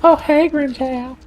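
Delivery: very high, silly cartoon voice; hammed-up high squeaky hero; Ichigo. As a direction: put on an absurdly high character voice. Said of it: "That's my Ichigo impression".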